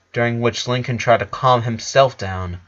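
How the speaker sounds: ordinary delivery, nothing marked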